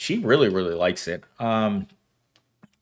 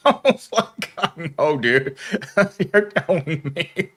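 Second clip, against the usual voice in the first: laughing; 7 semitones higher than usual. Real, not acted. Laughing agreement with coworker.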